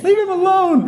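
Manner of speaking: high, shrill-free mock yell of a mom; mom yelling from the stands. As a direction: call out like a protective mom in the stands.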